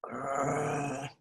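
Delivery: low muffled bear growl, half-asleep; sleepy muffled teddy-bear grrr. A narrator's reading. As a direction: growl softly as if under a pile of boxes. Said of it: "he heard a muffled".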